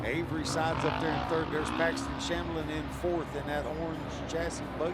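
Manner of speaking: energetic play-by-play race call; live race commentary. Acted. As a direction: call the race over the engines. Calls the race lap by lap.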